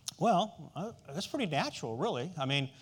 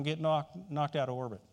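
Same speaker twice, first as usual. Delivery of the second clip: usual speaking voice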